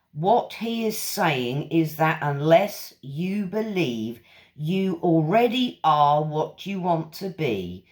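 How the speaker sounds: ordinary talk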